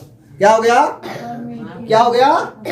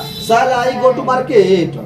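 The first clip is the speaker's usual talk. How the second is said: very slow question; slow teaching model; much slower than usual. Shown as a demonstration. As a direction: lay out the question word by word. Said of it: "negative में पहले आ जाएगा".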